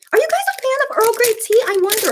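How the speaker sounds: excited. Real, not acted. Excited question to viewers.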